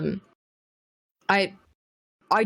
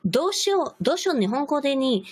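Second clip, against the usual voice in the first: high bubbly anime voice, much higher; cute anime girl voice; 7 semitones higher, much faster than usual. Pretend. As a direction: play a cute anime character. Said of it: "sound stereotypically Japanese".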